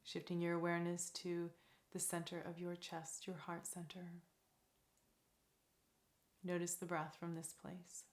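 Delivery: soft, calm meditation voice with long pauses; guided meditation. Real, not acted. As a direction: lead them gently into stillness, leaving space between phrases.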